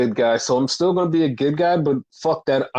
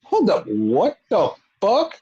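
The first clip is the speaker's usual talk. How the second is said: stunned; 4 semitones higher, slower than usual. Retold or imitated. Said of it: "it would have been like".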